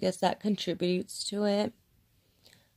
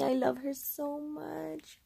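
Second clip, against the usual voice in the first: cooing to dog; 7 semitones higher, 6 dB quieter, slower than usual. Real, not acted.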